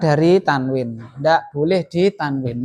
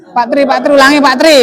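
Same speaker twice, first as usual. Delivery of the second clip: calling out; 7 semitones higher, 7 dB louder, faster than usual. Real, not acted. Teacher calls student to repeat.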